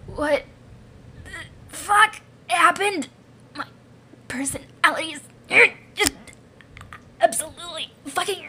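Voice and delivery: halting, strained outburst; horrified creature. Acted. Newly fused creature reacting to its own body, horrified. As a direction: drag out each word in horrified disbelief.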